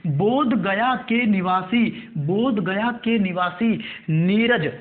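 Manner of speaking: slow, drawn-out words for note-takers; measured classroom dictation, very slow. Shown as a demonstration. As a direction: dictate word by word, stretching each one. Dictating a line for students to write.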